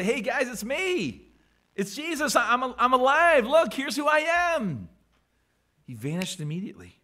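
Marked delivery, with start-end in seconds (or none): 0.0-4.9 higher, hammed-up cheer; mock excited reveal. Pretend Jesus excitedly reveals himself to his friends, hammed up. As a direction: bounce in announcing himself. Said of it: "he didn't sit around and say".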